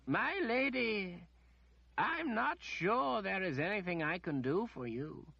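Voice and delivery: a lightly voiced, patient old wizard; the wizard calmly answering the demanding lady. A narrator's reading.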